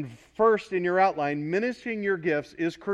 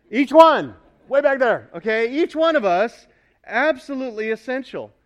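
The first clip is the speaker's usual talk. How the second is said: much louder and higher, calling out; calling to the back row; 5 semitones higher, 10 dB louder than usual. Real, not acted. Pastor calling out to people in the back row, loud. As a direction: project it to the very back of the room.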